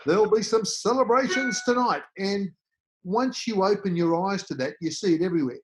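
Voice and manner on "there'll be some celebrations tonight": higher, louder, knowing wink of a newsreader; mock cheeky newscaster hinting at a big night; twinkle in the eye. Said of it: "the twinkle in their eye".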